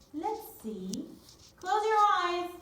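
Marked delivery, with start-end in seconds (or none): 1.6-2.5 playful command. Real, not acted. Teacher to pupils, calling out playfully. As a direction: close your eyes said high and loud. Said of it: "game instruction to class".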